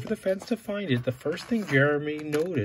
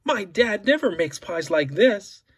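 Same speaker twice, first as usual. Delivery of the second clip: impressed; 6 semitones higher, 4 dB louder than usual. Acted. Jeremy admires pie.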